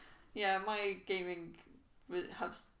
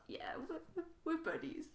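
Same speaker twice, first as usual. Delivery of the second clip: small, high, halting voice; hopeful insistence; 8 semitones higher, 6 dB quieter than usual. Acted. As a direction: softly insist on the friendship, pitched up. Insisting on friendship with game character.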